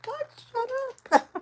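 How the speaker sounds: high, squeaky mock exasperation; a hammed-up "shut up" at a yelling mom; mock annoyed aside. Annoyed by a woman yelling at her kids, high mock snap. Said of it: "I'm like".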